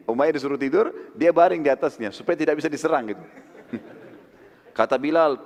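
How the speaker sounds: ordinary talk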